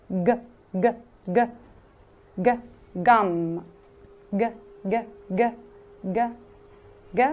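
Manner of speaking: ج as a hard English g, repeated; teacher drilling the g sound; hard g demo. Shown as a demonstration. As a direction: clip out a hard g from the throat. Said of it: "لكن نطقه ج".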